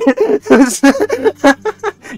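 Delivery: high, loud burst of laughter; real laughing fit. Real, not acted. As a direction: laugh hard at your own anecdote. Cracks up at the customer's confusion.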